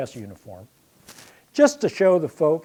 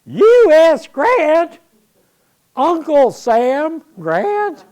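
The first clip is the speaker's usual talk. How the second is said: high, loud, slow drawn-out call; mocking yell; 12 semitones higher, 14 dB louder, much slower than usual. Sherman mocks the new cadet's initials from afar, slow loud call. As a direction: stretch the name out teasingly across the field. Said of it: "this Western Ohio accented voice yells out".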